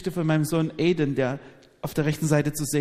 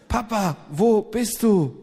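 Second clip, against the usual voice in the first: put-on higher, drawn-out child's call; imitated little son; 5 semitones higher, slower than usual. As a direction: mimic a small boy calling for papa. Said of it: "Und er sagte".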